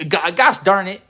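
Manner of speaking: hammed-up clean swear; mock wholesome cuss. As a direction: ham up the goody-goody swear. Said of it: "We gotta talk like we ... like our parents are around".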